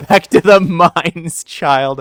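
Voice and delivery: slow, loud, laughing order; laughing mock command. Streamer jokingly orders her mod back to work, laughing and loud. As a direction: boss the mod around as a joke.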